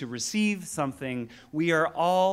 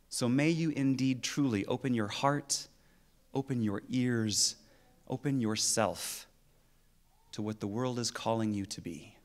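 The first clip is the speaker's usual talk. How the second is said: solemn blessing; 4 semitones lower, 3 dB quieter than usual. Real, not acted. Minister blessing the congregation to open their hearts, solemn and gentle. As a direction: bring it gently down, phrase by phrase.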